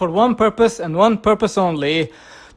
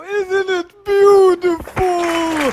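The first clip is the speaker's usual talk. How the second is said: mock weeping; 7 semitones higher, much slower than usual. Admiring his new logo.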